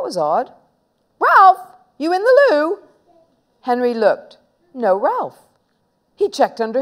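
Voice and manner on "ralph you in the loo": high, loud searching call. Acted. Boy checking the loo for his friend, high call. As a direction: call through the door, pitched high.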